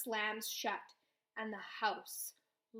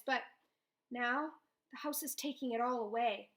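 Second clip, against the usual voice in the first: ordinary delivery, nothing marked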